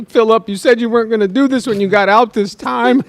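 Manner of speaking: high-pitched impression of a mother; scolding mom. Storyteller voices mom confronting her son, high mock scolding. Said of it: "Then his mom comes out".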